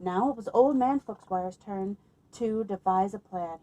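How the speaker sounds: usual speaking voice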